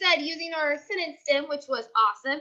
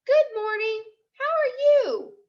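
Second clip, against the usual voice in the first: bright, much higher sing-song good morning; cheery greeting; 8 semitones higher than usual. Acted. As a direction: greet the monster sweetly. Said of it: "You would say".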